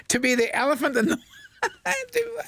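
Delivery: giggling through the words; can't stop laughing mid-question; breaking up with giggles. Real, not acted. Host giggling through teaser.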